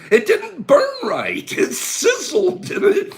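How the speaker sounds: high, squealing through laughter; barely getting it out for laughing; helpless real laughter. Real, not acted. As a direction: drag the words out while cracking up. Cracking up retelling a bad smoke.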